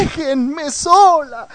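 gimoteo agudo y lento, imitando a una pony; llanto fingido. Retold or imitated. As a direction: lloriquea la frase, muy agudo y alargado. Quote de pony llorando. (llanto burlón agudo)

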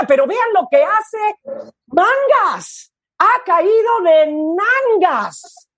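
excited sports commentator; live play-by-play. Pretend. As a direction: call the dive live, rising to shouts. Mock live dive commentary.

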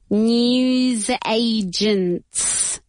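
NEWS AGENTS drawn right out; very slow, teacherly word demo; slow model pronunciation. Shown as a demonstration. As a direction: stretch each syllable of "News agents" for learners. Teacher models new word slowly.

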